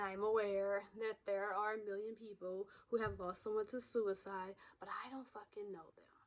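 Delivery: bitter whisper trailing off; whispered aside. Real, not acted. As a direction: drop into a harsh private whisper. Confessional aside, dropping voice.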